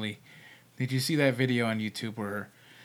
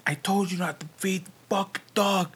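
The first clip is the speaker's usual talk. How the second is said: mock scolding in a higher voice; pitched-up retold yell, held back in volume; 5 semitones higher than usual. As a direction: imitate an angry caretaker berating someone. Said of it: "was yelling at this woman".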